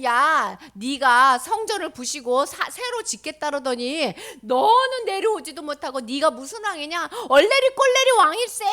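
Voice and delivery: loud mocking, rising to a high sing-song; teasing taunt. Crowd teasing Jesus on the cross, loud mockery. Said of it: "그러면서 막 놀리면서".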